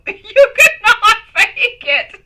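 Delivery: slow, laughing through the words. Real, not acted. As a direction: giggle it out slowly. Laughing fit in the studio.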